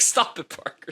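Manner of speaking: high, strained plea to stop; imitating himself fighting back tears; mock pleading. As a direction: beg him to stop, half-laughing. Retold fighting tears at speech.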